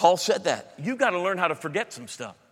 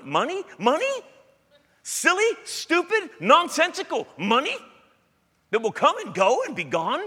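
incredulous scoff; 13 semitones higher, 5 dB louder than usual. Real, not acted. Preacher scoffing at churchgoers who fret about money, incredulous. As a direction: scoff at the very idea of it.

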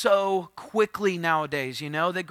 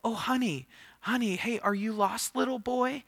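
higher, soft motherly coo; sweet mom; 6 semitones higher, 4 dB quieter than usual. Retold or imitated. Mom approaching a lost child, sweet and soft. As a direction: gently coax a lost child. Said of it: "She was a sweet mom".

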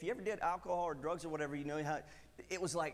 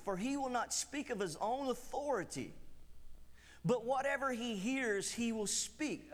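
scripture reading; 5 semitones higher than usual. Acted. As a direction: read it out as holy word.

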